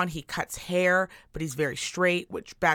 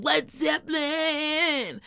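high, drawn-out cry on the band name; exasperated shout; 9 semitones higher, much slower than usual. Real, not acted. Ranting about male singers to co-host, exasperated.

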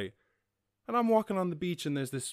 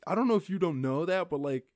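telling off; 9 semitones higher, 9 dB louder than usual. Retold or imitated. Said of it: "'Cause I was like".

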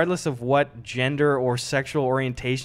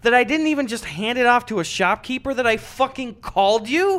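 higher, louder incredulous rant; exasperated rant; 8 semitones higher, 5 dB louder than usual. Retold or imitated. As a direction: let the outrage spill over. Teller's retold exasperated rant.

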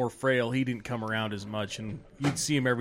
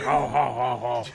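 Tim Allen laugh; 4 dB louder than usual. Retold or imitated. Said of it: "Tool Time Tim Allen".

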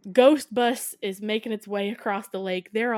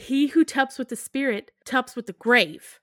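loud, higher, emphatic pronouncement; proclaimed warning; 4 semitones higher, 6 dB louder than usual. Retold or imitated. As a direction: punch it out like a solemn curse. Warning first proclaimed, later rattled off.

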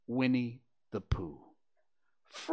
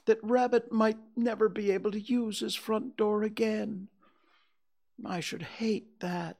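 high, plaintive bear voice; pitched high throughout, slightly pitiful; sniffly bear; 10 semitones higher than usual. A narrator's reading. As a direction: worry aloud as a forlorn little bear. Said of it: "sniffing slightly".

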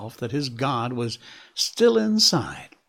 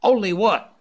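sharp, high, loud snap; snapped; 9 semitones higher, 8 dB louder than usual. A narrator's reading. Scott snapping impatiently at the dog musher. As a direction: bite the question off short. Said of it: "Scott snapped out".

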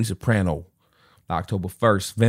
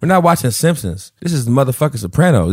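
louder, higher, scolding his friend; outraged rant; 7 semitones higher, 5 dB louder than usual. Real, not acted. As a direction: rant at him in mock disbelief. Outraged friend hasn't seen Sopranos.

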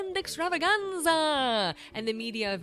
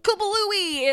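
dramatic kablooey; 11 semitones higher, 9 dB louder than usual. Real, not acted. Comic explosion word.

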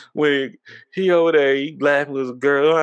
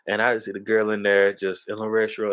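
ordinary talk

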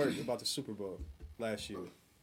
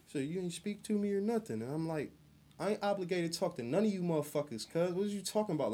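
higher, defiant retort; indignant comeback; 7 semitones higher than usual. Retold or imitated. Telling off coworkers at the table, higher defiant retort. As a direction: push back like they owe you.

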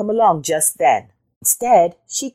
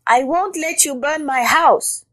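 high-pitched indignant rabbit; indignant cry; 10 semitones higher than usual. A narrator's reading. Rabbit defending the house from Jackal, high indignation. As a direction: refuse in a high outraged voice. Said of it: "cried Rabbit".

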